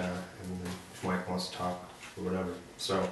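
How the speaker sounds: normal talking voice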